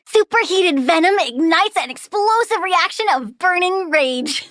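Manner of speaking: usual speaking voice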